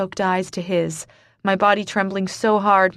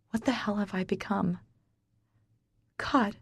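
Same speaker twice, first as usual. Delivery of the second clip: quiet despair; 8 dB quieter than usual. Acted. Despairing inner question.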